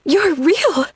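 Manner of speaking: high, loud shocked yell. Acted. Woman discovering the demon is real, high startled yell. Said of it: "she yells into the air".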